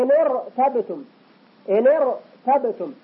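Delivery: ارتبتم said slowly with a thick R after the broken ن; slow ارتبتم with a heavy R. Shown as a demonstration. Teacher demonstrates ارتبتم. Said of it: "إن ساكنة".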